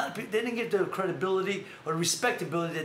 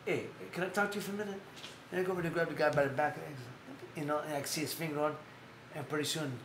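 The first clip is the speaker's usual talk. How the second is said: hushed retold talk; 6 semitones lower, 8 dB quieter, faster than usual. Eddie's retold quiet word, apology.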